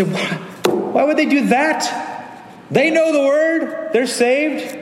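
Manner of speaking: mock indignation.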